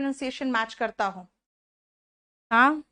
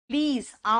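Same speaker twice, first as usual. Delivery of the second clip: normal talking voice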